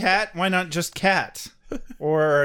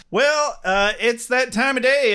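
ordinary talk

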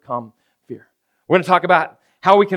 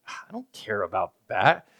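softer, slow and lower, a hint of the bored wife; wife dismissive; 6 semitones lower, 8 dB quieter, slower than usual. Pastor imitating his wife brushing off football, soft and low. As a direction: drop it low and uninterested. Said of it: "She's like".

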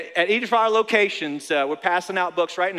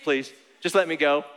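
mock plea; 4 semitones lower than usual. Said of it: "screaming inside".

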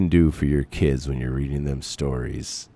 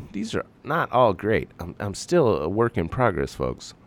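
ordinary talk, nothing marked

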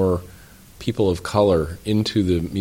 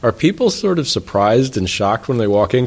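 usual speaking voice

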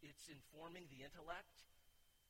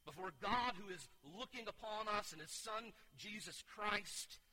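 higher, louder fervent preaching; 5 semitones higher, 6 dB louder than usual. Acted. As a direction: press the charge home from the pulpit. Powerful charge, full preaching.

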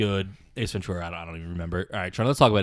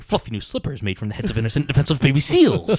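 higher, louder comic movie-star impression; Jim Carrey; 4 semitones higher, 5 dB louder than usual. Trying a film star's slipper line on friends, hammed-up. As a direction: build to a big, over-the-top finish. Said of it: "I'm gonna try to do a Jim Carrey".

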